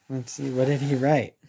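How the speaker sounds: ordinary delivery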